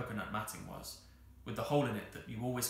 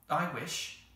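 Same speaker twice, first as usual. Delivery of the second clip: measured, slightly raised wishing; more slowly; 6 semitones higher, 4 dB louder than usual. A narrator's reading. Anthea makes her second wish, more slowly. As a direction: make the wish deliberately. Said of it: "said Anthea more slowly".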